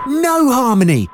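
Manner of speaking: a high, sharp scolding no. A narrator's reading. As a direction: scold her like a worried friend. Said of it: "Gemma scolded".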